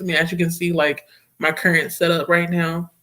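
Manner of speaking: ordinary talk, nothing marked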